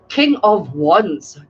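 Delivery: King of Wands drawn out very slowly; dramatic slow reveal; slow announcement. Acted. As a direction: unveil the card, King of Wands, word by word. Announcing the card just drawn.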